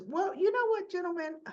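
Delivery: high, light social voice; polite excusing. Retold or imitated. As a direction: break up the meeting in a high, airy voice. Laura excuses herself from meeting.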